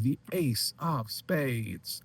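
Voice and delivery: slow, high showman's announcement; drawn-out reveal. Acted. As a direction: stretch each word out for the big reveal.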